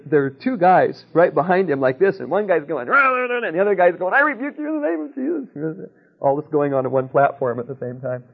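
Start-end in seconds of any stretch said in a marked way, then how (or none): 2.9-3.5 high, loud nonsense ranting syllables; mock ranting noise. Mimicking a street heckler's rah rah babble. Mocking a rival street preacher. 4.1-5.9 high-pitched hammed-up preacher's rebuke; mock rebuke. Retelling John rebuking a heckler, hammed-up preacher voice.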